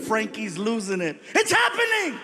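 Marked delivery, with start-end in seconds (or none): 1.3-2.2 high, frantic cry; panicked son. Retold or imitated. Son panicking as the cop pulls them over, high frantic cry. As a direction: panic like a scared teen.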